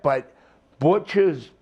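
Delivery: ordinary talk, nothing marked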